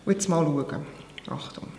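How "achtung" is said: whispering. Real, not acted. About to taste the food.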